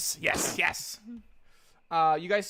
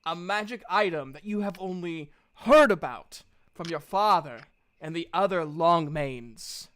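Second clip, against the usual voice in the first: usual speaking voice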